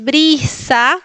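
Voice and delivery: "brisa" with a drawn-out Latin ee; smiling I. Shown as a demonstration. Teacher shows what it is not, "brisa" with smiling I. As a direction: smile and hold the I in "brisa". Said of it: "como sonriendo".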